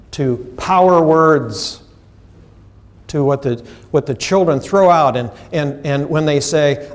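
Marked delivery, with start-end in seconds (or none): none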